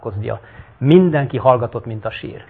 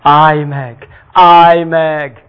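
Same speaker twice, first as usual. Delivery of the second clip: loud, pleading shout; crying out; 4 semitones higher, 9 dB louder, much slower than usual. Acted. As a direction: beg him to stop, loudly. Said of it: "sírt a sok nyomorék".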